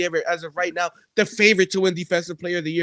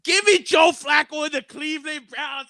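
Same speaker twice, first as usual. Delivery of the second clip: big grinning shout, higher; smiling shout; 10 semitones higher, 3 dB louder than usual. Pretend. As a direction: beam while you yell it out. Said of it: "I'ma smile while I say".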